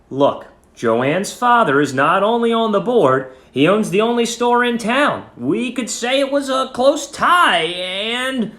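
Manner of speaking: plain reading voice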